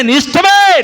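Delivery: నీ ఇష్టమే cried out high and loud. Shown as a demonstration. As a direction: lift it into a cry of surrender. Prayer quote repeated, second take raised.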